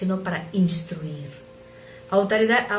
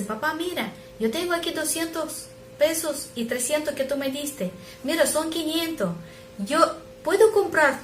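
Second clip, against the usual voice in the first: put-on high, excited little boy showing his money; a small child pitched up, proudly offering; child's voice; 8 semitones higher than usual. Son offering to buy his father's day, high excited child voice.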